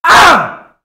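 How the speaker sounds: sudden high loud cry of frustration; exasperated shout; frustrated yell. Real, not acted. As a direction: burst out in exasperation. Outburst before rant.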